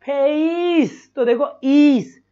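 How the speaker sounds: फेस dragged slowly toward ईस; फेस stretched ending in ईस; slow demo. Shown as a demonstration. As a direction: show the ई glide in फेस slowly. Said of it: "ये होठ बड़ खा रहे हैं".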